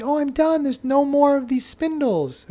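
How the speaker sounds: put-on high, bright little child's voice, pitched well up; child announcing done. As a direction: play a proud small child. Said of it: "The child's gonna show you".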